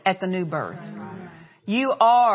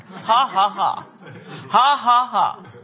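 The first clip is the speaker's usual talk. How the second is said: inspired laugh; 2 semitones higher, 6 dB louder, slower than usual. Retold or imitated. Preacher shows the man's laugh at the devil, loud bold laugh. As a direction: laugh boldly at the enemy. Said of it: "he says it sounds just that inspired".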